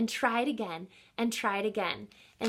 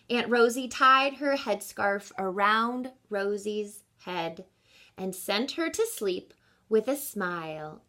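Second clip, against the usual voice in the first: story narration. A narrator's reading. Teacher finishing the picture book for children, calm narration. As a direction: read it gently toward bedtime.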